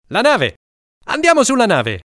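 high, loud eager shout; excited kid's shout. Acted. Mariassa urging the boys toward the ship, high and loud.

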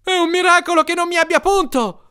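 very high, agitated exclamation; outraged cry. A narrator's reading. Family member nearly stung by scorpion, crying out. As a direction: cry out in alarmed indignation.

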